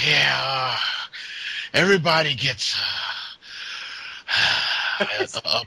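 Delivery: wheezing, slow, out-of-breath words. Pretend. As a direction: gasp through it like a winded fat man. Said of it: "He's out of breath".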